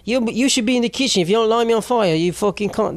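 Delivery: wobbly mock Australian, almost Cockney; bad Australian accent. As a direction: put on a hammed-up drunk Aussie accent. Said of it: "I have to be an Australian accent".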